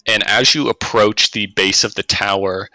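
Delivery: normal talking voice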